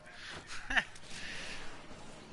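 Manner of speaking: breathy embarrassed laugh at himself; real laugh. Real, not acted. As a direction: laugh it off, caught out. Laughs at forgetting own age.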